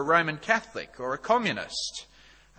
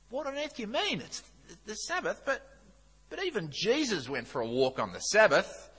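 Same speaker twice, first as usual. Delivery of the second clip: higher-pitched, hammed-up protest; mock bafflement; 7 semitones higher than usual. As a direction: play the baffled man objecting in a joke. Newcomer protests in joke.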